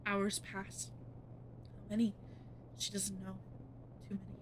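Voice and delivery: creepy narrator voice; story narration. A narrator's reading. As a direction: let the time drag. Narration of passing time.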